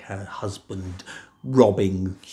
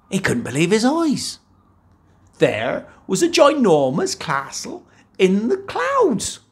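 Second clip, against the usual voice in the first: amazed; 10 semitones higher, 3 dB louder than usual. A narrator's reading. Narrator's amazement at castle.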